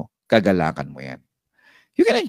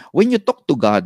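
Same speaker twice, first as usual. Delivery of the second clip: ordinary delivery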